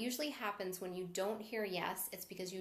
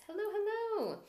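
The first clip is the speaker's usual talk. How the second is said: much higher, bright hello; excited greeting; 13 semitones higher, 5 dB louder than usual. Real, not acted. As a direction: welcome them warmly and high. Greeting new viewers.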